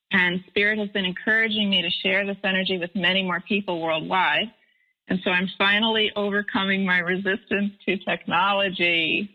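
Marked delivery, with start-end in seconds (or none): none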